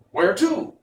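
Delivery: high, hollow-headed wooden man; Pumpkinhead voice. Acted. Jack Pumpkinhead asks Tip where they go, high voice. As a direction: pitch it way up like a carved pumpkin man.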